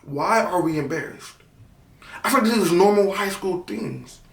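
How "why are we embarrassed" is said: ordinary talk, nothing marked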